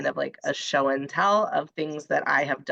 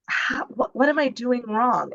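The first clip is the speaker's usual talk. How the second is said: higher-pitched, baffled self-questioning; acted exasperation; 8 semitones higher than usual. Teacher recalling doubts over students' questions, exasperated. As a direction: replay your own bewildered frustration. Acting out her own past frustration.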